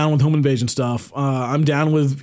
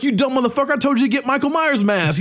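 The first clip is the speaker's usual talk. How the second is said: higher mock chewing-out; a heist boss mock-scolding his crew, pitched up; angry heist boss; 8 semitones higher than usual. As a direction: yell at the idiot, half joking. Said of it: "they're like".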